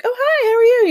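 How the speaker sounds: imitating her own sing-song friendly hello; chirpy greeting. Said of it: "and I'd be like".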